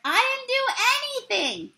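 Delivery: yelled. A narrator's reading. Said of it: "yelled the catcher".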